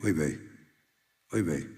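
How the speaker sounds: low, soft oy vey; dismayed groan. Acted. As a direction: sigh it out, low and weary. Rebbe sighs in dismay.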